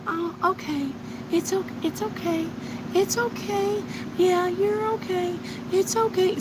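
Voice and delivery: high, soft, timid mock voice of a pushover; meek pushover. Mocking the viewer who meekly says it's okay. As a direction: imitate a mousy people-pleaser, high and hushed. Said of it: "Instead of going".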